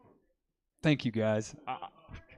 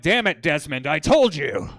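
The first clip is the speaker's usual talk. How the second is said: loud mock outburst; exasperated scolding; 9 dB louder, slower than usual. Comic scolding an imagined Desmond, exasperated and loud. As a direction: scold him at full volume.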